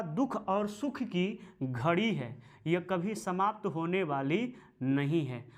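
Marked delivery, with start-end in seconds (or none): none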